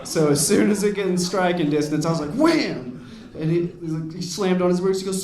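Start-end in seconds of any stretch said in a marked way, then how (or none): 2.3-3.1 high, loud "wham" with a swoop; exclaimed impact. Acted. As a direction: act out the hit with a raised cry. Acting out throwing rock at car.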